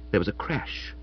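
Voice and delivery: ordinary delivery, nothing marked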